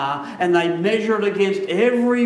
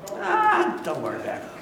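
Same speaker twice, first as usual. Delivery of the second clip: attempted Brooklyn accent; 12 semitones higher than usual. Preacher voicing God excusing a little sin, attempted Brooklyn accent. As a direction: shrug it off in a mock Brooklyn accent. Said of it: "God's got a Brooklyn accent".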